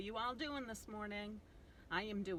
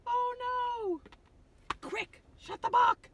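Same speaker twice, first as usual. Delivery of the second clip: high, loud, playful alarm; mock panic; 9 dB louder, slower than usual. Reader urges kids to shut the book, playful panic. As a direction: gasp and hurry them to close it.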